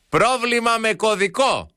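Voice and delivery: high, slow, stretched yell; mock shouting at the phone. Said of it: "Και φωνάζω ακόμα περισσότερο". (ψηλή, αργή φωνή σαν να μιλάει σε μηχάνημα)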